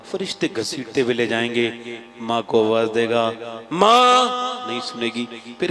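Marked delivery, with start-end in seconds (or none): 3.7-4.7 calling out. Acted. Son calling mother from hell.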